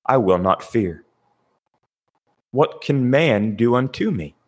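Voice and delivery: ordinary talk, nothing marked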